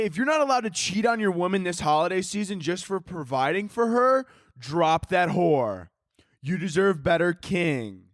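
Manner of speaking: alpha bro advice. Acted. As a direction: preach alpha nonsense like a bro guru.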